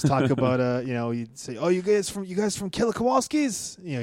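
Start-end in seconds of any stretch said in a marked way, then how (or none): 1.6-3.8 raised-pitch New England accent impression. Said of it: "he had the, the New England accent".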